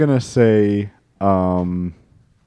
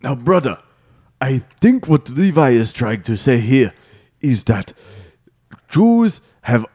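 put-on Wakandan accent. Student speaking up for Levi, Wakandan accent. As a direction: explain it solemnly in a Wakandan accent. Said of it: "keep that accent throughout the whole thing".

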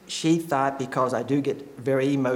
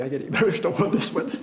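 choked up; 5 semitones higher, faster than usual. Real, not acted. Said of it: "you have to excuse me".

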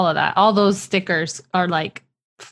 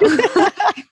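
a big delighted cackle; loud high laugh; 11 semitones higher, 8 dB louder than usual. Real, not acted. Host cracking up at the pantry joke, loud high laugh.

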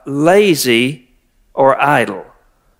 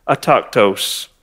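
slow, low "autochthos", dropping in scorn; with disdain; 5 semitones lower, 3 dB quieter, slower than usual. Shown as a demonstration. Pastor condemning the idle faction, with disdain. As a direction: let the word fall with contempt. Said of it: "say it with a little bit of disdain".

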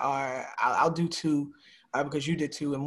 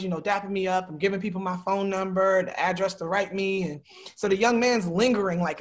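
ordinary talk

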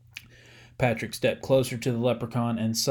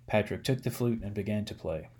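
usual speaking voice

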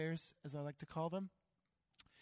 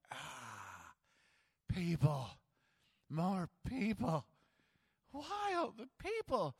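sag at the sight of yet more crowds; weary exasperation; much slower than usual. Retold or imitated. Tired disciples groaning as they land and see the crowds. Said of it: "being like".

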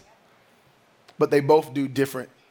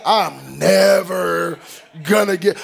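very slow, stretching "never"; drawn-out despair; 4 dB louder, slower than usual. Retold or imitated. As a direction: drag "never" out like it hurts. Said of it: "first day of class".

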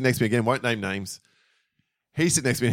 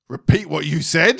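slow, higher imitation of a strict teacher; stern teacher demand; 6 semitones higher, 4 dB louder than usual. Imitating teacher confronting pupil, slow stern demand. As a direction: demand it slowly, pitch raised.

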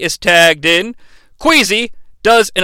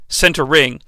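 usual speaking voice

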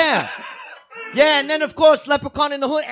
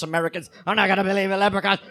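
ordinary talk

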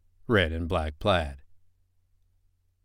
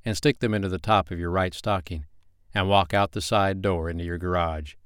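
plain reading voice

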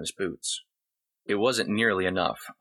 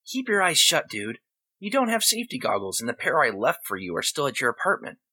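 put-on a kid warning firmly; high boyish command; boy's voice; 7 semitones higher than usual. Boy instructs Jed.

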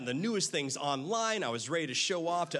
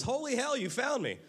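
surprised exclaim; 9 semitones higher than usual. Retold or imitated. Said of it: "he looks at me and goes".

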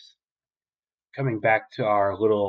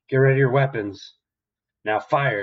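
loud commanding shout to open fire; captain yelling at his men to fire, loud command; barked order; 4 semitones higher, 6 dB louder than usual. Retold or imitated.

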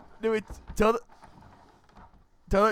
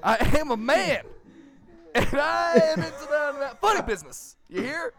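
higher-pitched, indignant mock prospector; old prospector; 7 semitones higher than usual. Prospector setting the tavern owner straight, hammed-up indignation. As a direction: protest like a cartoon Old West prospector.

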